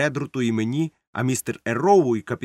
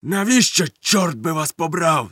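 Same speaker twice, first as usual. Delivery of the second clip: loud, raised-pitch cursing outburst; angry shout; 5 semitones higher, 4 dB louder than usual. A narrator's reading. Long John curses the sailors, shouting. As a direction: curse at them, high and loud. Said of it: "закричав довготелесий".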